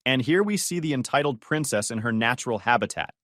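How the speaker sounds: usual speaking voice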